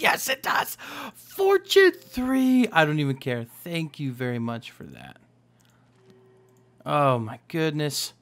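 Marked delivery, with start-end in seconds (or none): 0.0-2.7 high, loud, stretched-out cry of triumph; excited cheer. Real, not acted. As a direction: celebrate it at the top of your voice. Celebrates getting the wanted enchantment.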